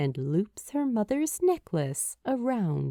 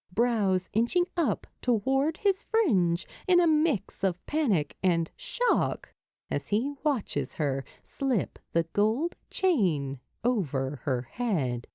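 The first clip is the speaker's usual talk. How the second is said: usual speaking voice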